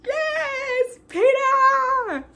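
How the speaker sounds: very high, drawn-out cheer; hammed-up squealing cheer, held long; celebrating. Fan cheering Peeta's rescue, celebrating in a high squeal. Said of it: "You know, I'm celebrating".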